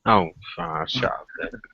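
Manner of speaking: tipsy, slurring a little. Real, not acted. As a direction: talk like you've had a few drinks. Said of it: "I'm a bit pissed".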